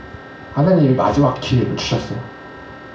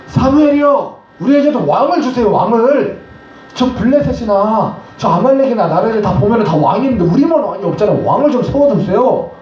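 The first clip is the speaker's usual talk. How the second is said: high, pleading crowd voice pushing hard; people begging for a king; 8 semitones higher than usual. Acted. Israelites pleading with Samuel for a king, high and insistent.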